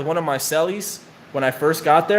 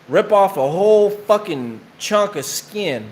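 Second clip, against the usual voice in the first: ordinary talk, nothing marked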